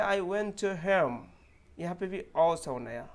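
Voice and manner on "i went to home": slow, drawn-out teaching read; slow example read. Shown as a demonstration. Teacher slowly reading a sample sentence aloud. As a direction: stretch every word for the learner. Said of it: "दूसरा sentence है".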